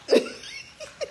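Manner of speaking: very high squealing laugh; helpless high-pitched laughter. Real, not acted. Cracks up at the misread message.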